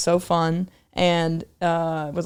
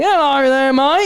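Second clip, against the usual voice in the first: higher, louder hammed-up British; mock British accent; 6 semitones higher, 5 dB louder, slower than usual. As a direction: exaggerate a British lad's accent. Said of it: "cut to me going back".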